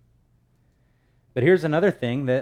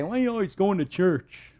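mocking relatives; 8 semitones higher than usual. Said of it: "And things they say".

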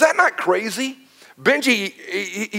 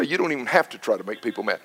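softer, quick teasing family quote; 9 semitones lower, 8 dB quieter, faster than usual. Retold or imitated. Wife and kids teasing the pastor, quick and soft. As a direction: toss it off like his kids ribbing him. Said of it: "as Barb and my kids would say".

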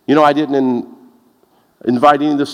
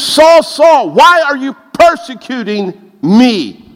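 calling out Saul; 7 semitones higher, 5 dB louder, slower than usual. Acted.

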